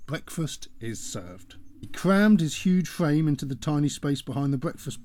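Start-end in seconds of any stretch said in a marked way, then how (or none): 0.1-1.6 low, slow, soft appliance announcement; a hint of a robotic cooker; lightly voiced machine voice. A narrator's reading. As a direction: machine voice, softly announcing the meal.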